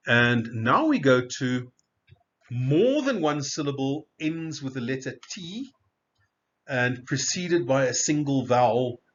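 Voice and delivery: ordinary talk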